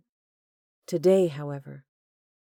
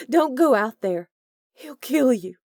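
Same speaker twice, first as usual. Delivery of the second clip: high, loud, frightened begging; frightened plea; 9 semitones higher, 9 dB louder than usual. A narrator's reading. Delaney begs Corbin not to go out, frightened loud plea. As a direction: plead with him in panic.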